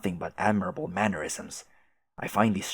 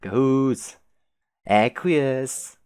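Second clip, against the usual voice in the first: amused repetition; 5 semitones higher, 4 dB louder, much slower than usual. Real, not acted. Repeating words with amusement.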